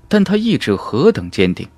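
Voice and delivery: ordinary delivery, nothing marked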